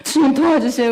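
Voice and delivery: mother praying aloud as the police stop the car; pleading prayer. Retold or imitated. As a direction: beg the Lord in a rush of panic. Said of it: "막 기도한 거예요".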